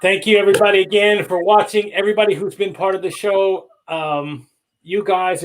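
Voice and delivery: energetic announcer goodbye to the audience; show sign-off. Acted. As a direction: wrap the show like a broadcast host thanking viewers. Host signs off the show.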